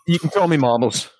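put-on British accent. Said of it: "that's not the accent I'm gonna go with".

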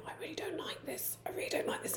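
put-on whispered child's grumble; quiet complaint. Six-year-old son privately objecting to his spaghetti, quiet whisper. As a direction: imitate a small boy whispering under his breath. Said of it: "like, saying quietly".

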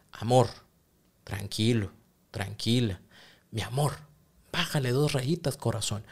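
soft, gentle coaxing; softly calming an upset partner; soothing calm-down. Shown as a demonstration. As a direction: talk your partner down softly. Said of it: "con la confianza de decir".

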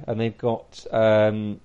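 ordinary talk

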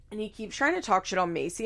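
usual speaking voice

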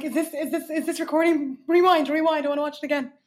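high, loud, thrilled; excited. Retold or imitated. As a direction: squeal it like a giddy fan. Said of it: "I was like".

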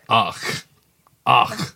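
echoing a Scottish exclamation; lightly imitated Scottish accent. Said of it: "who talks like that as well".

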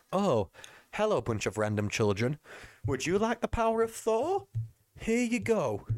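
lightly hamming up a careless Thor handing out powers; mock Thor. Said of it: "he's like".

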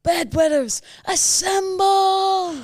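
high, loud holler, last word hugely stretched; drawn-out parody battle cry; mock Anchorman rallying call. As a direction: summon the troops in a mock-heroic shout. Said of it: "We could go".

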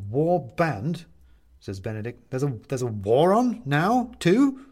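can't believe there's a war too; Benedict, dismayed, questions the goblins about a war; incredulous captive. Acted.

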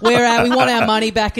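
loud laughing demand for a refund; mock-furious dad at the front desk.

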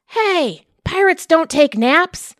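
high, loud, slow indignant yell; indignant shout. Acted. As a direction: wake the crew with it. Pirates fall asleep.

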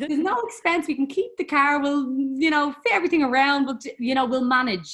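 imitating her naive confident self; mock breezy confidence. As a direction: play the carefree planner. Mock-confident plan for twins.

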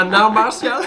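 laughing through the words; giggling it out. Real, not acted. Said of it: "mais qu'est-ce qu'il raconte?".

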